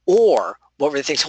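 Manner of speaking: ordinary talk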